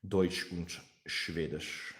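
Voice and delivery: slow, over-enunciated German accent; exaggerated German accent. Shown as a demonstration. Streamer showing viewers a German accent, heavily exaggerated. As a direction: stretch it out in a hammed-up German accent. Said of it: "I'm just exaggerating".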